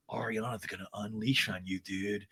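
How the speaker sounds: low put-on dude voice; Sandoval. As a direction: imitate a man warning in a hushed low voice. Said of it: "Sandoval to Trailer Girl".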